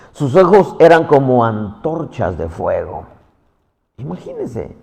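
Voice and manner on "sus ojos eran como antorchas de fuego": ordinary delivery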